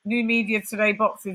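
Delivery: usual speaking voice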